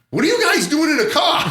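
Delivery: very high-pitched mock call-out; astonished fireman. Fireman at the window spotting the winos, high astonishment.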